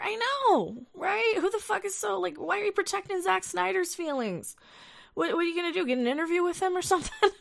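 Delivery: mock the critic in a high, incredulous rant. Real, not acted. Streamer ranting at a critic, high and incredulous.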